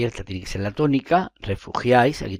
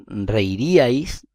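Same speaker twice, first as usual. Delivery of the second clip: slow reiríais, spelled out syllable by syllable; slow demo word; 3 dB louder, much slower than usual. Shown as a demonstration. Teacher to students, slow reiríais, syllables pulled apart. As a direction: draw out reiríais so every syllable is heard. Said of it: "en el caso de".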